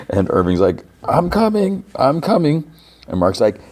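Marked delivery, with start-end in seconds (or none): 1.0-2.7 high mock shout without real volume; mock calling back. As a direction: imitate hollering a reply across distance. Irving calls back, retold.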